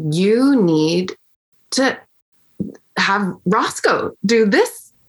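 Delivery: slow, spaced-out words for emphasis; imitating a colleague's deliberate insistence; emphatic urging. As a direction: retell an insistent plea, word by word.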